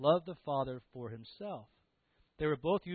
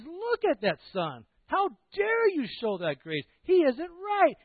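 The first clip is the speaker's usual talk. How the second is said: loud, high indignant outburst; scandalised, raised-voice accusation; angry older brother; 11 semitones higher, 7 dB louder, slower than usual. Acted. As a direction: rail in outrage, voice climbing. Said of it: "he, first he's angry".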